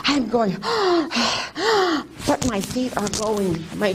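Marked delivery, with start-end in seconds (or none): 0.6-2.2 exaggerated out-of-breath gasps; demonstrated panting for air; acted gasping. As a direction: gasp hard as if winded from running. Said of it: "I am so out of breath".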